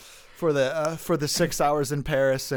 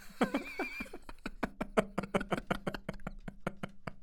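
long breathy laugh; 13 semitones higher, 10 dB quieter than usual. Real, not acted. Laughing at compliment.

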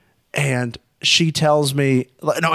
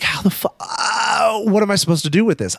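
exasperated; 8 semitones higher than usual. Retold or imitated. Retells own helpless exasperation.

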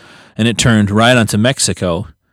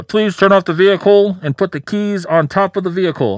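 lightly voiced cop order, higher; police command; 8 semitones higher than usual. Cop ordering the driver out, mock command voice. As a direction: bark it like an officer.

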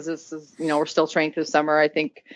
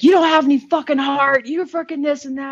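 coach yelling; 9 semitones higher than usual. Retold or imitated. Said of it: "And he's like".

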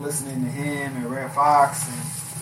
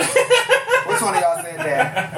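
laughing disbelief; much slower than usual. Retold or imitated. Poet reacting to heckler.

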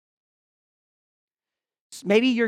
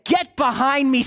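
stern rebuke; 5 semitones higher, 3 dB louder than usual. Retold or imitated. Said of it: "he rebuked Peter and said".

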